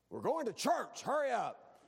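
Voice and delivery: high, loud mock shout; impatient yell. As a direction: rush the family out the door. Family rushing to church.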